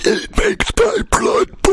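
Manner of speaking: high breathy doom voice; dramatic whisper-shout. Pretend. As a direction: proclaim doom in a hoarse whisper. Same dramatic bit.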